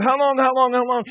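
very high, loud repeated plea; crying out. Acted. Believer wanting to hear the Lord, desperate crying out. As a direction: shout it urgently up to God. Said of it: "crying out".

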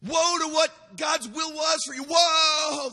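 high, loud shouted woes, slow; crying out woe. Retold or imitated. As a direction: cry each woe out high and hard. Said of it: "That's what he's crying out".

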